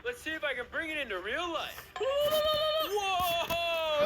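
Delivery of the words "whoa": long high amazed whoa. Acted. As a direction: gasp in wonder. Hot dog appears from drawing.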